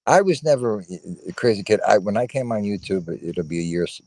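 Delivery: ordinary talk, nothing marked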